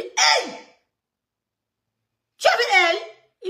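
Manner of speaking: high, loud shocked cry of disbelief; shocked outcry. Retold or imitated. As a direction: blurt it out in disbelief. Shocked reaction to cousin's news.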